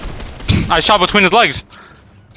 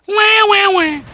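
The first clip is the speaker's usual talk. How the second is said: mock crying taunt; 14 semitones higher than usual. Gamer mocking a teammate, high fake crybaby wailing. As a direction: taunt him like a baby.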